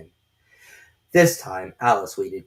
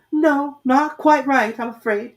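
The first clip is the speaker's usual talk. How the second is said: timid; 8 dB louder, slower than usual. A narrator's reading. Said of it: "said Alice timidly".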